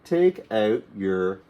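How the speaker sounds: "Take out your crayons" said very slowly and clearly; slow model phrase. Shown as a demonstration. Teacher modelling a command to learners, very slow. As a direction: stretch each word for beginners. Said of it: "we'll say".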